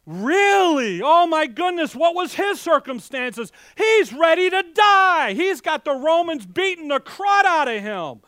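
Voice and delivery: loud, high and fired up; preacher getting worked up; animated. Real, not acted. Preacher fired up about Christ's suffering, loud and high. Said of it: "Sorry, a little an-, animated".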